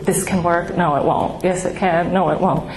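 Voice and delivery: ordinary delivery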